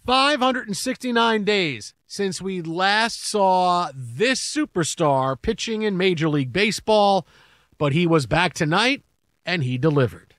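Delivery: slow, weighty announcer voice; dramatic broadcast intro. Acted. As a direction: build it up like a big TV intro.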